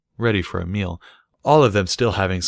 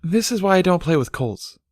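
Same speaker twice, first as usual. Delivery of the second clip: lightly voiced, higher child; little filly; 11 semitones higher than usual. A narrator's reading. A hint of a filly dismissing colts. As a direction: declare it like a smug kid.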